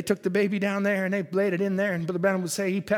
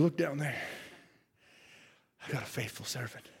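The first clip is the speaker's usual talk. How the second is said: hushed God; 9 semitones lower, 13 dB quieter than usual. Acted. God speaks low and hushed.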